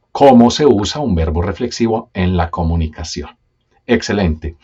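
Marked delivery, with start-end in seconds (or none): none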